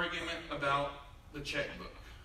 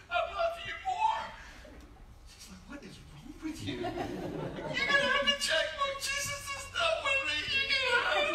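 hammed-up sobbing; 5 dB louder than usual. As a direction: wail it through mock tears. Sobbing surrender at altar.

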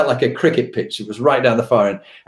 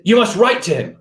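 high-pitched mock-alarmed command; retold urgent order; 9 semitones higher, 3 dB louder than usual. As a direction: imitate a boss insisting urgently. Retold boss ordering an apology.

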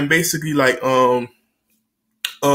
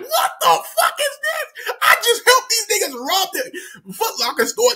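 very high, hammed-up wail of disbelief; aghast. Kid learning of second robbery, aghast wail.

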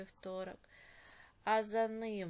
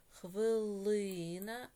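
slow dictation; 4 dB louder, much slower than usual. Shown as a demonstration. Teacher dictates while underlining.

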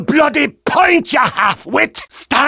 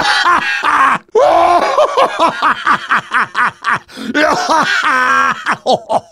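hearty laughter. Real, not acted. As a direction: laugh it out long. Closing laughter after lines.

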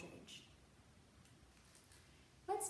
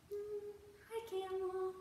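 high cheery greeting; 9 semitones higher than usual. Pretend. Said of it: "don't forget to smile".

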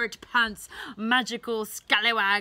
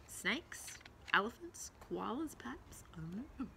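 much softer, breathy whisper; 12 dB quieter than usual. Acted. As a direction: whisper it as an unsure aside. Trailing uncertain whisper.

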